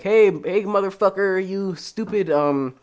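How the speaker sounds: loud, jeering bully voice; hammed-up bully taunting a kid; mock bully. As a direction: sneer it like a schoolyard bully. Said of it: "they're like".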